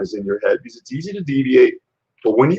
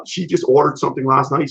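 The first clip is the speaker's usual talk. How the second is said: normal talking voice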